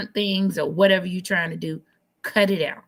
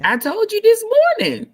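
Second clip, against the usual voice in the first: high-pitched teasing yell; high teasing shout; 6 dB louder than usual. Real, not acted. Teasing cohost about low mic gain.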